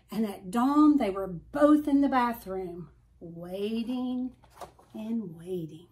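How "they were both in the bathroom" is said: ordinary delivery, nothing marked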